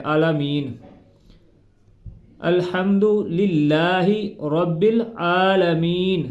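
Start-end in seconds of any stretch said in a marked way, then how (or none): 2.5-6.2 slow, with ع and the long آ in العالمین clear; deliberate, letter-true reading; careful correct pronunciation. Shown as a demonstration. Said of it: "کھڑی زبر ہے اس کو زبر پڑھ دیتے ہیں".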